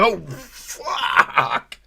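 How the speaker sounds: mock cry as it falls; laughing mock shout; monkey falling. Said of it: "He's like".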